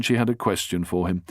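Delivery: ordinary delivery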